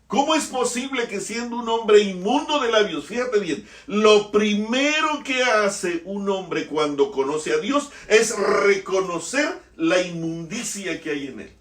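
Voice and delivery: loud, high preaching at full force; fiery preaching. Acted. Preacher to the congregation about knowing the Father, fiery. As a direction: thunder the majesty of the Father.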